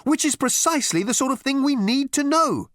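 high-pitched, strident insistence; insistent. A narrator's reading. Marketing girl answering Ford, insistent. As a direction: press the point hard. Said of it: "insisted the girl".

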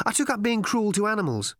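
high, casual boast; airy. A narrator's reading. Ford tells Arthur of his hobby, airily. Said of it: "he said airily".

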